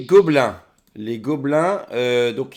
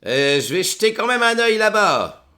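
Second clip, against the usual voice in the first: NPC voice; 8 semitones higher, 4 dB louder than usual. Acted.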